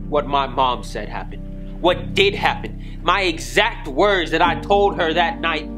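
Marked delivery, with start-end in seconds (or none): 1.8-5.7 high, emphatic, climbing to an outburst; angry insistence. Acted. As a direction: hammer home that they were told. Accused character insists on truth.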